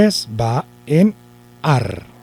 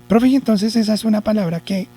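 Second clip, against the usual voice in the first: higher pupil's voice, questioning; imagined pupil; 7 semitones higher than usual. Acted. Pupil asks teacher what type of word, imagined pupil voice. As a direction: mimic a puzzled pupil, raised pitch.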